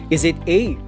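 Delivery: ordinary talk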